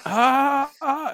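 high-pitched cry of "ah", retold; acted-out high cry of grief; high anguished wail. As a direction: imitate a distraught father's high wail. Acts out dad finding dead dog.

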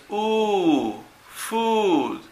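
"U. Food." with a drawn-out oo; long vowel demo. Shown as a demonstration. Teacher isolates U in Food, long vowel demo. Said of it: "Los sonidos largos".

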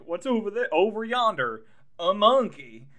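playful trick voice; mock distraction. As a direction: point off and fool him. Al distracting Walken, retold.

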